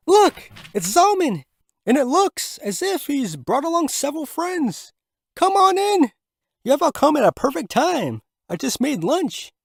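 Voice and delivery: a hostess delighted at guests; high excited welcome; Manette's voice. Acted.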